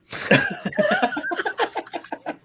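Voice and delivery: high-pitched burst of laughter; real high giggling fit. Real, not acted. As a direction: crack up in a long, high cackle. Laughs after mentioning [name].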